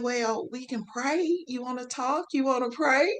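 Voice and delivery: put-on quiet tender baby talk; gentle to baby. Talking softly to baby.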